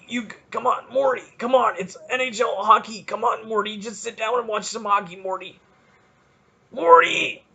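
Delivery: lightly done rambling Rick voice, stammering Morty; a hint of a frantic cartoon scientist; Rick Sanchez impression. Podcaster doing his Rick voice for listeners, lightly done, stammering. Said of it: "That's my Rick Sanchez voice".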